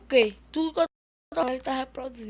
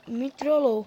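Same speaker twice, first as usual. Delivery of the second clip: usual speaking voice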